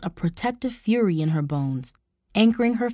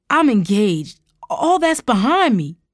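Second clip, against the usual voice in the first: raised, urgent plea; pleading; 3 semitones higher, 6 dB louder than usual. A narrator's reading. Uncle begs niece to let it go, urgent pleading. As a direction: plead louder, pitch climbing high. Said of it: "her uncle pleaded".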